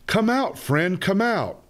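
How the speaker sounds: high calling shout; mild storybook shout. A narrator's reading. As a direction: call out up high to a friend. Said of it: "Mouse starts to shout".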